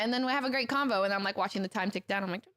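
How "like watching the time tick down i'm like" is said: ordinary talk, nothing marked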